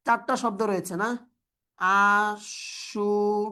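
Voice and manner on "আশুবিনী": very slow, drawn-out "আশুবিনী"; syllable by syllable. Shown as a demonstration. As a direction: stretch "আশুবিনী" out, pausing between syllables. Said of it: "আমরা জানি আশুবিনী".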